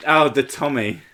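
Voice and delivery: usual speaking voice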